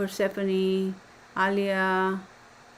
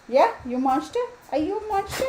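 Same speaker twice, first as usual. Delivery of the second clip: playful doggy talk, pitched up; teasing pet talk; 11 semitones higher than usual. Real, not acted. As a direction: tease your dog in a cooing high voice. Teasing her woken dog.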